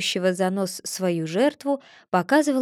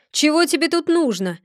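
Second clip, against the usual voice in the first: stern angry shout; стern shout; 6 semitones higher, 4 dB louder than usual. A narrator's reading. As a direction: bark it sternly at the intruder. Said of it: "сурово закричал вышедший кузнец". (суровый окрик)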